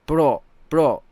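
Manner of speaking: short, loud "bro," twice; Alfia impression. Streamer retrying a teammate's bro, short loud mimicry. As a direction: imitate a teammate in two separate punched takes.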